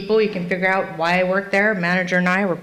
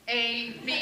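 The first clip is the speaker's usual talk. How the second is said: put-on quiet drunken mumble; 10 dB quieter than usual. Drunk reciting alphabet.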